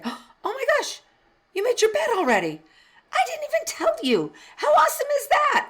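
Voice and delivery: high, loud, delighted gushing; overjoyed grandma praising a little boy; gushing praise. Retold or imitated. Grandma praising grandson's made bed.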